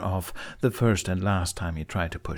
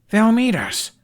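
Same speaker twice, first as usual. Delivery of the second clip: high, loud burst of relief; excited outburst; 8 dB louder, slower than usual. A narrator's reading. Hiccup thrilled reading father's reply, excited cry. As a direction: blurt out the good news. Hiccup reads good news.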